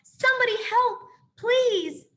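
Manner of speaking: high, loud begging cry; panicked mother pleading; desperate plea. A narrator's reading. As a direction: beg for help in panic. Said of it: "cried a mother hare".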